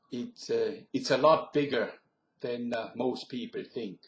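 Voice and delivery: German-tinged Pennsylvania Dutch English; Pennsylvania Dutch accent. Shown as a demonstration. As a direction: talk like a Pennsylvania Dutch local from Kutztown. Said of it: "this is the accent I often heard there".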